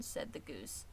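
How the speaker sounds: ordinary delivery, nothing marked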